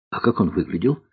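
ordinary delivery, nothing marked

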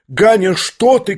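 high-pitched cry of a mother; alarmed cry. A narrator's reading. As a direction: cry out high to stop your son. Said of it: "крикнула Нина Александровна". (испуганный материнский вскрик)

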